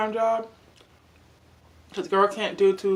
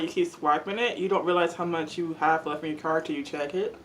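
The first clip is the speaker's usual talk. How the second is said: normal talking voice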